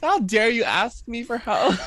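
high, loud, hammed-up outrage; mock-offended and drawn out; mock indignation. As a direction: imitate an affronted volunteer, stretching it out. Listener mocks the indignant volunteer.

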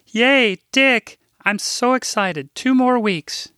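high, loud squeal of delight; thrilled older lady cheering; excited Rose. Acted. Rose delighted about Dick's visit.

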